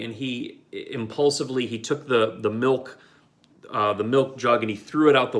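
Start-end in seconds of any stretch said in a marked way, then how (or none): none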